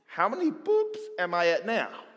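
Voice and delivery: usual speaking voice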